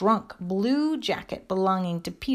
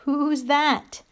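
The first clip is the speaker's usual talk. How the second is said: high, louder startled question; little frightened call; 6 semitones higher, 5 dB louder than usual. A narrator's reading. Small creature interrupted by a knock, frightened call. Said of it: "A little frightened voice called out".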